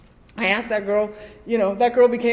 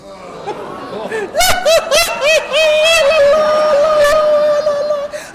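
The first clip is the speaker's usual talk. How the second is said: long, loud, high-pitched comic bawl; hammed-up wailing; 6 dB louder than usual. Dumped woman reads the Post-it note, hammed-up wailing. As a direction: wail on and on in mock despair. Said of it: "There was tears and snot".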